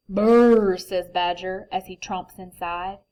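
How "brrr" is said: loud shivering brrr; cold shiver brrr. Acted.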